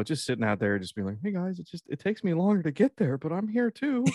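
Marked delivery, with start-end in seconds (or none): 1.2-4.0 high, small pleading voice; be the tiny planet left behind; pitched-up pitiful straggler; sad little Pluto. Acted. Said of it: "I feel so bad for Pluto".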